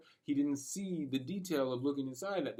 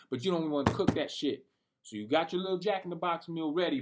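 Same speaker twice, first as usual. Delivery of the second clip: ordinary talk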